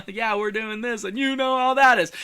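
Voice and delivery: high, breezy and upbeat; lighthearted. Shown as a demonstration. Running a meeting, opening it high and lighthearted. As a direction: toss it off in a high, cheery lilt. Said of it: "start off lighthearted".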